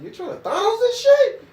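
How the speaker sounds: mother teasing her son in disbelief; mock outraged. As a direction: call out the kid.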